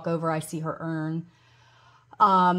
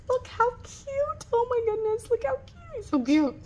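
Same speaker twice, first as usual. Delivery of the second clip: gushing over cuteness. Real, not acted. Showing viewers the cute mail she was sent, gushing. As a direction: coo at something adorable in a tiny high voice.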